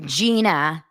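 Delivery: ordinary delivery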